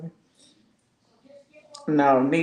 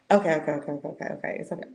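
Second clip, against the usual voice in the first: rapid-fire okays fading softer; hurried placating; 3 dB quieter, much faster than usual. Retold or imitated. Friend hastily backing down mid-quarrel, hurried placating. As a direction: rattle it off to smooth things over.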